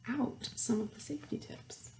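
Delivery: teacher whispering a secret to the class; whispered aside. Real, not acted. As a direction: let them in on the joke in a whisper.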